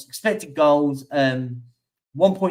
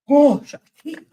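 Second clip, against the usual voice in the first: yawning; 10 semitones higher, 7 dB louder than usual. Real, not acted. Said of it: "I'm so sorry for the yawning".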